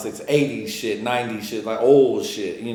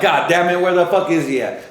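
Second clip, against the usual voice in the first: higher, louder exasperated cursing; replaying his irritation at the empty store; frustrated outburst; 7 semitones higher, 7 dB louder than usual. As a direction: vent like he's gone missing. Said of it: "I'm like".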